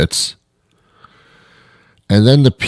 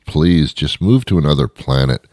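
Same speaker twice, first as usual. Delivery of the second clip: ordinary talk, nothing marked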